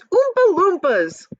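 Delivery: high, loud, slow exclamation; excited cry. Acted. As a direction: stretch it out in wonder. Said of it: "everyone cried at once".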